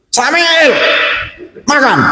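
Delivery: put-on high, drawn-out call to a child; mother calling out. Mother calls child to eat, high holler. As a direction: imitate a mother summoning her kid.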